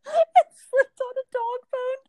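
high, squeaky voice breaking with laughter; laughing while talking. Real, not acted. Telling friend about son slipping on dog bone, helpless laughter. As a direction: barely get it out through real laughter. Retelling a hilarious family mishap.

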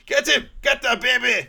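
high, loud hammed-up yell; laughing cartoon shout; mock shout. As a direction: bellow it from the back of the room. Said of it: "Fat Bass in the background going".

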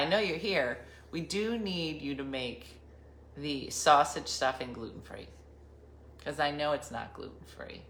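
ordinary talk

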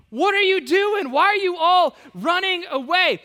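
high, loud, acted outburst of disbelief; outraged. Young David challenging his fleeing army, outraged. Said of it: "is outraged by this".

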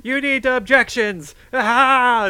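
loud, high, drawn-out hollering; mock courtroom shouting. Friend imitating a bumbling self as lawyer, mock shouting. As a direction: blurt it out at full volume like a clueless fake lawyer. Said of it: "just start shouting out very, like, official sounding words".

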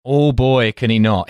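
usual speaking voice

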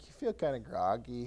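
low, soft groggy mumble; a touch of just-woken grogginess, voice dropped. Acted. Preacher illustrating waking up from a nap, mildly groggy. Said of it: "when you wake up from a nap".

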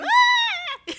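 high, loud imitated scream; mock scream. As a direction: let out a sudden high-pitched mock shriek. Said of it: "She screams".

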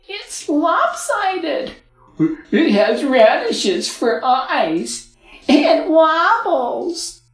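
high, slow, loud mocking kids; taunting children. A narrator's reading. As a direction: jeer like cruel children.

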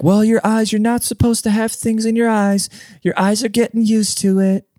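high-pitched imitation of clinic staff; mock clinic staff. Clinic staff explaining contacts to a patient, high mock voice.

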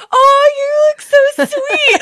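high, loud sugary voice, slowed; fake sweet customers. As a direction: gush like a suddenly peachy customer. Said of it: "They're like".

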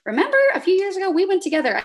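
high, fast, mock exasperated reminder; lightly voiced annoyed friend. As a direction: rattle it off, a bit put-out. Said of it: "You're like".

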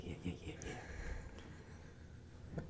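very quiet, low trailing yeahs; hushed agreement. Retold or imitated. Recruit going along with being put on the saw, hushed. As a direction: mumble along under your breath. Meek reply to big-boy remark.

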